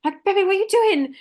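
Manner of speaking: high, loud startled concern; alarmed. Retold or imitated. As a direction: cry out in fright at night. Waking to see partner squatting.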